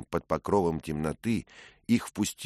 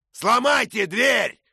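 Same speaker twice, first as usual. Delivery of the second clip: much higher, loud, slow bellow; roaring order; 10 dB louder, slower than usual. Acted. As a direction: roar the command to break in. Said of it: "проорал сэр Дэниел".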